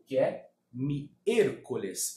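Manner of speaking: ordinary talk, nothing marked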